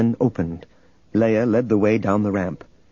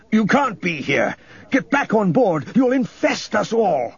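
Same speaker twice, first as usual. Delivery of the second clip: high, alarmed exclamations; horrified outburst; 11 semitones higher than usual. A narrator's reading. Governor fears infection from Luke, horrified outburst. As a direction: order them away in high-pitched horror. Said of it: "Horror twisted Governor Nereus's heavy features".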